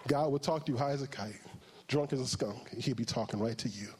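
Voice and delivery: normal talking voice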